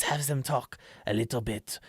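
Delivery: lightly accented cat-folk voice; Khajiit. Shown as a demonstration. Introducing his cat-folk character to viewers, Khajiit voice. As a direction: ease into a cat-person's voice. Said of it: "I always think Khajiit from Skyrim".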